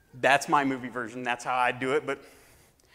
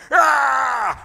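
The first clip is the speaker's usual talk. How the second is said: sudden high, loud war yell; battle cry; 10 semitones higher, 11 dB louder than usual. Acted. Warriors charging the enemy lines, a loud high battle cry. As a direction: let out a charging warrior's scream.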